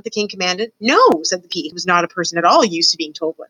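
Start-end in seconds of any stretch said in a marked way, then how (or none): 0.8-1.2 higher, louder defiant no; defiant refusal. A narrator's reading. Princess not used to orders, defiant. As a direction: refuse point-blank.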